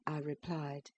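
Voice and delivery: ordinary delivery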